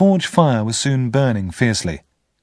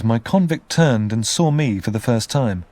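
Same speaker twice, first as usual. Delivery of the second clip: usual speaking voice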